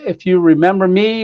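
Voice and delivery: ordinary delivery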